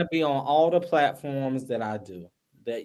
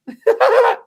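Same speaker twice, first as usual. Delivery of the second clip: loud high burst of laughter; sudden loud giggle; 9 dB louder than usual. Real, not acted. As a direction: let out a big laugh.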